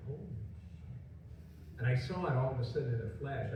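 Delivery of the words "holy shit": very slow, breathless whisper; stunned whisper. Retold or imitated. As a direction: whisper it as the idea hits. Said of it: "I was like".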